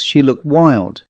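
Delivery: ordinary delivery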